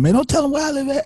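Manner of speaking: high, quick mock-alarmed voice; joking protest. Homeboy jokes about police learning his address, mock protest. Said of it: "he made a joke".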